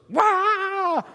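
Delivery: sudden high shriek, loud and short; startled shriek. Retold or imitated. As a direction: shriek at the tick as she flings it. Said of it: "she goes".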